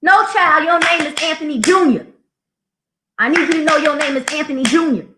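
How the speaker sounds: high, firm sassy correction; teacher scolding. Retold or imitated. Teacher corrects Junebug, high and sassy. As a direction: set the kid straight.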